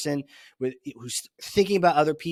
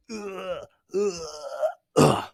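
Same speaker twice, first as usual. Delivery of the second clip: mock straining grunts; slower than usual. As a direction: fake effort grunts. Mock lifting heavy hammer.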